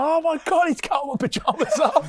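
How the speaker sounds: put-on high shocked kid's cry; shocked kids. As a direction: gasp it out like a startled child. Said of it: "they're like".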